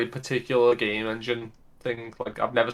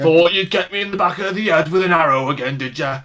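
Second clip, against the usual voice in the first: orc voice; 4 semitones higher, 4 dB louder than usual. Retold or imitated. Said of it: "cool, like, voice lines for it".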